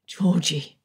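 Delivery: usual speaking voice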